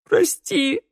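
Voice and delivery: the plea bursts into a wail; wailing sob. Acted. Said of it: "рыдал Раду".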